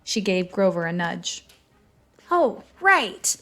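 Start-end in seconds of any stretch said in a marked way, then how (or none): 2.3-3.4 high-pitched sidekick voice; hastily supportive, high voice; chiming in. A narrator's reading. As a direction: jump in late, voice swooping high. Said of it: "he chimed in".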